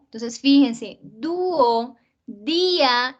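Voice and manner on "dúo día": dúo, día split as DÚ-o, DÍ-a; two-syllable hiatus. Shown as a demonstration. Teacher proving they are not monosyllables, dúo, día in two beats. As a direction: hold the stressed vowel in dúo and día, then the second. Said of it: "no son monosílabos".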